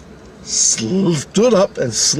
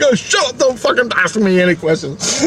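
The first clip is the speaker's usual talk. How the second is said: flustered snapping; 10 semitones higher than usual. Retold or imitated. Snapping at questioning coworker.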